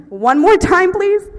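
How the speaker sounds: high, loud officer impression; insistent cop. Cop asks for a repeat.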